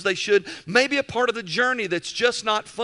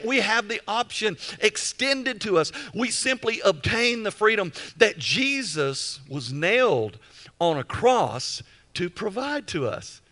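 ordinary talk

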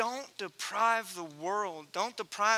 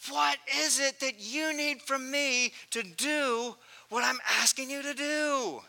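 desperate plea; 7 semitones higher, slower than usual. Retold or imitated. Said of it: "in desperation".

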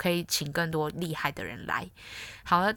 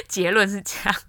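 louder, laughing as she wraps up; amused laughing close; 4 semitones higher, 7 dB louder than usual. Real, not acted. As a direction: laugh through the conclusion. Laughs at her title idea.

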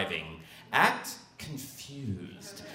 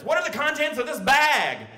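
stern cop; 12 semitones higher, 4 dB louder than usual. Retold or imitated. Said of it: "he looks at me and says".

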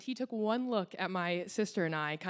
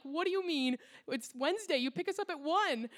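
put-on higher, indignant teen complaint; pissed off; 8 semitones higher than usual. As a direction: demand an answer, exasperated. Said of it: "And we are pissed off".

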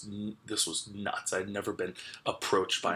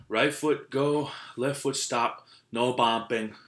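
put-on loud, higher Russian-accented orders, first words drawn out; imitated Russian accent; 3 semitones higher, 5 dB louder, slower than usual. Russian course runner telling racers the rules, imitated accent. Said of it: "because these are Russians".

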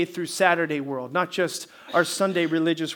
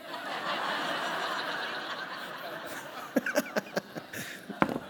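silent, wheezing laugh; real amused laugh after the joke; breathy laugh; 4 semitones higher, 10 dB quieter than usual. Real, not acted. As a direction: laugh at your own punchline. Pastor laughs at own joke.

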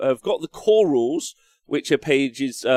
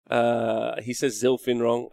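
normal talking voice

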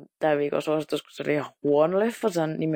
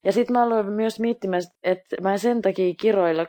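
ordinary talk, nothing marked

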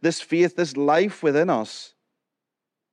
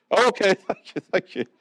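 laughing; 6 semitones higher than usual. Real, not acted. Congregation laughs at his joke.

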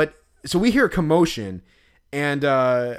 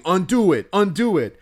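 mock yell; 6 semitones higher, 4 dB louder than usual. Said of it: "the guy's saying".

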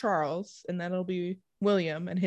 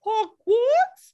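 put-on high and loud joke accent; screamed mock accent; 8 dB louder than usual. A cohost repeating her question, screamed in a joke accent. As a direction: yell it like a cartoon. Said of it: "everything is just screamed".

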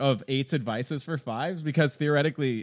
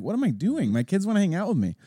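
higher, louder mock self-scolding; exasperated self-reproach; 10 semitones higher, 7 dB louder than usual. Parent catching himself pushing the kids away, exasperated self-reproach. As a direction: quote yourself in incredulous dismay.

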